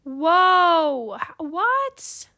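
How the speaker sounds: loud, high stretched whoa; amazed whoa. Real, not acted. Gamer marvelling at a friend's trick, amazed.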